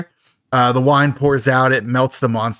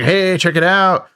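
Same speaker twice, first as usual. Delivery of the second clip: higher, drawn-out show-off call; mock excitement; 6 semitones higher than usual. Recapper voicing Dave showing Adrian a bottle, playfully excited. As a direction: imitate someone pleased with a find. Acts Dave finding alcohol.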